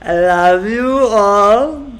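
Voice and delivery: slow, loud, crying I love you all; slow tearful cry of love, loud. Real, not acted. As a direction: let it break into happy tears. Emotional farewell, cry heard.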